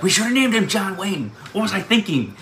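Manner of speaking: playful idea. Retold or imitated. Jokey retold thought.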